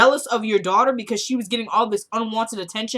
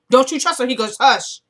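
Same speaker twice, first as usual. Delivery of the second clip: retold insistence; 4 semitones higher, 7 dB louder than usual. Father insists and hushes.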